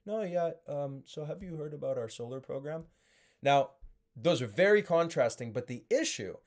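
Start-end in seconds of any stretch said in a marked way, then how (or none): 0.0-2.8 lower, much softer, deflated phone opener; low monotone. Shown as a demonstration. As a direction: mumble it down low with no energy. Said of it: "And some people are just".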